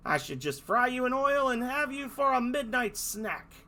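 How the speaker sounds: high, loud hammed-up villain; villain roar. Dolphin shouting threats at the fairies. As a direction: yell the threat like a cartoon baddie.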